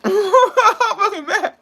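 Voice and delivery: high, slow, laughing through the words; a real forced laugh hiding anger; laughing while mad. Real, not acted. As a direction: say you're furious but laugh it out.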